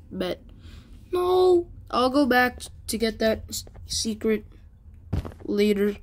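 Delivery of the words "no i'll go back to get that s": normal talking voice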